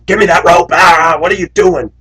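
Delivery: put-on old angry self. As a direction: bark at the kid like he used to. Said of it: "I used to get very mad at that".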